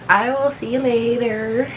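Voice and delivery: high, slow sing-song goodbye; bright farewell to viewers; cheerful outro. Real, not acted.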